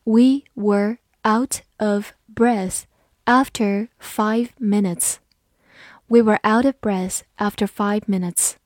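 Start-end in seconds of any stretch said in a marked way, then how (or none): 0.0-5.2 slow, careful reading, each word spaced out; deliberately slowed classroom read. Shown as a demonstration. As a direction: read it slowly for learners to follow. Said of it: "好，慢读一遍".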